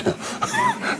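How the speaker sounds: short high burst of laughter; a quick high giggle. Real, not acted. Amused speaker breaks into a high laugh. Amused by his own story.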